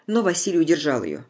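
usual speaking voice

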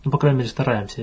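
ordinary talk

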